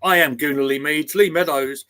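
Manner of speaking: ordinary talk, nothing marked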